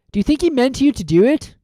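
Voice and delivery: put-on curious boy asking his friend; Ron's voice. Ron voiced in audiobook.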